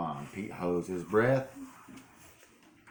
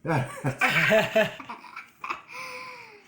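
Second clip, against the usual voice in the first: high laugh; 5 dB louder than usual. Real, not acted. Adult laughs at child.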